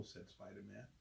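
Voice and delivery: ordinary delivery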